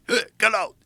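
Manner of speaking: high, loud yell at the liver; imitated yell. Healer yelling at the liver, mock high shout. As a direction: bark it out like an exorcist. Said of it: "he started yelling at my organ".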